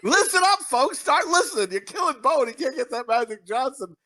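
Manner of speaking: high-pitched plea to the audience; hammed-up rallying call; mock urgent appeal. Mock appeal to listeners for Bone.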